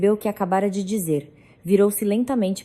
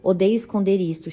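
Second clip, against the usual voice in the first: usual speaking voice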